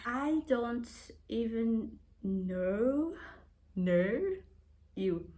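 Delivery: drawn-out, slow Australian accent; exaggerated Australian accent. Shown as a demonstration. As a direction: stretch "know" in a mock Australian accent. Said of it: "Most people say I have an Australian accent".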